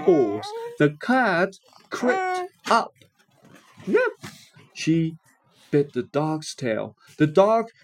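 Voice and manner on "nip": nip sound. A narrator's reading. Cat bites dog's tail.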